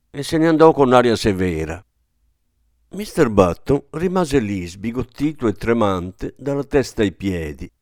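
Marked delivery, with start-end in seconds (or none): none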